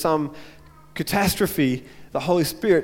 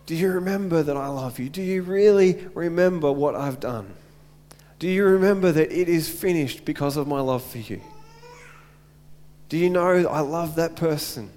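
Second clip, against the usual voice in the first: a loving father gently comforting his son; gentle voice of God. Retold or imitated. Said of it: "gently says".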